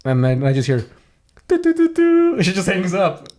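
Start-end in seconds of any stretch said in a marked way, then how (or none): 1.5-2.3 high sung electronic beeps; imitated phone tones. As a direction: mimic the tones of a dropped call. Imitates phone tones as she hangs up.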